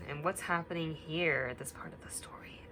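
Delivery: hushed storytime whisper. Acted. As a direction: whisper as if the room got dark. Story gets darker, whispering.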